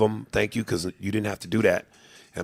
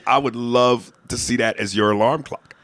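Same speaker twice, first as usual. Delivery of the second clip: usual speaking voice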